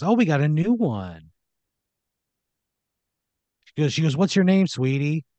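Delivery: high, cooing boss voice; boss meeting newcomer. Acted. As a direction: coo at the new little worker. Owner sizes up the newcomer.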